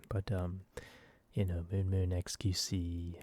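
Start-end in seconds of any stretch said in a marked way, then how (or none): none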